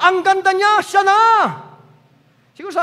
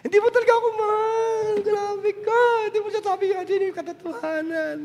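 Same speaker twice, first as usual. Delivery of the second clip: mock sobbing; 5 semitones higher, 4 dB quieter than usual. Delilah fake-crying reproach.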